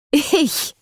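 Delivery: high-pitched, incredulous question; bitter disbelief. A narrator's reading. Eden reacts to Jackson's accusation, bitterly incredulous. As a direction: throw it back, stung and incredulous. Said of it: "Eden lachte bitter".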